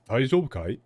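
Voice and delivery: casual masculine Japanese. Retold or imitated. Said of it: "very casual and very, like, masculine".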